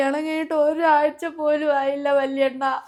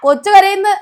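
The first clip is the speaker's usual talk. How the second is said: put-on loud, higher cries over the baby; upset shout; 5 semitones higher, 8 dB louder than usual. Mother yelling that her child is crying, loud.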